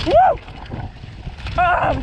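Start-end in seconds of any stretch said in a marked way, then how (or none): 0.1-0.4 high loud whoop; excited whoop. Real, not acted. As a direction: let out a thrilled whoop. Whoops while riding downhill.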